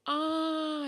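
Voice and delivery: long, high, loud "ah"; drawn-out cry of sudden recall; realisation "ah". Real, not acted. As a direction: let out a high, ringing "ah". Sudden realisation while recalling news.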